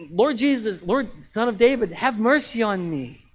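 loud, high plea for mercy; desperate acted shout, last words drawn out; crying out. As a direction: cry out to be heard over the crowd. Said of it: "begin to cry out instinctively".

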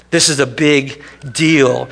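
slowed, heavy on this; slow emphatic statement. Real, not acted. Preacher telling congregation the resurrection matters, slow and weighty. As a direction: lay it down slowly.

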